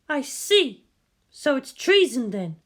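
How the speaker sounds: Misato's voice. A narrator's reading.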